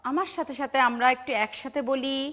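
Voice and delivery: ordinary talk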